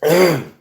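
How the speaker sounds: sharp cough; a short choking cough. Real, not acted. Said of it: "I'm choking".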